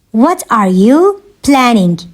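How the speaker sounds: measured, word-grouped delivery; slow teaching repeat. Shown as a demonstration. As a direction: separate it clearly for learners. Teacher repeats question slowly for learners.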